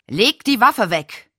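slow, loud, harsh order; Louis ordering Edgar to drop the gun, harsh; brusque command. Acted. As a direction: snap it out as a stern warning. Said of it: "Die nächsten Worte waren schroff".

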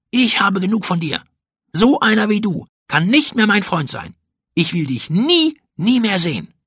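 high, indignant, fed-up voice; angry rejection. A narrator's reading. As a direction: break off the friendship in outrage. Pauli ends friendship after fight.

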